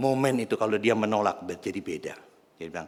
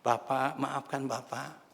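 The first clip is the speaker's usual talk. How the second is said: soft, slow, higher-pitched address; tender, drawn-out girlish plea; gentle voice; 4 semitones higher, 3 dB quieter, much slower than usual. Acted. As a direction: approach a stranger gently and apologetically. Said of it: "ada suara lembut".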